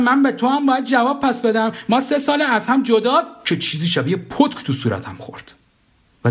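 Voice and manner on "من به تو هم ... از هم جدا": very high, indignant protest; heated retort. Acted. Narrator refuses to answer to his ex-wife, heated retort. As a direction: protest hotly until cut short.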